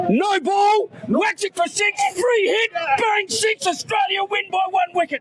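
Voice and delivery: high, shouted mock commentary; excited play-by-play. Fan calling an imagined winning finish, excited shouting. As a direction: build the imagined last ball into a victory cry.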